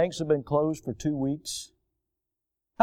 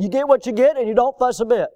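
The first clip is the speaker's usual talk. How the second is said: higher and louder, a firm lunch-line rule; mock stern teacher setting the rule; 7 semitones higher, 4 dB louder than usual. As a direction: lay down the rule to a fussy kid. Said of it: "That was her saying".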